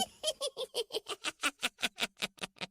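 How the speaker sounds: high witch cackle; evil cackle. Acted.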